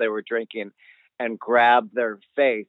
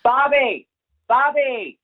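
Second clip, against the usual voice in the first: playful name call; 9 semitones higher, slower than usual. Shown as a demonstration. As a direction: call out Bobby across a bar. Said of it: "start yelling somebody's name".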